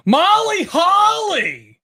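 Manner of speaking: high, loud, drawn-out cheer of a name; all-caps shout. Real, not acted. Host cheers the contestant who got it, shouting. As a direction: shout it slowly, way up high, like capital letters. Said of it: "in caps locks".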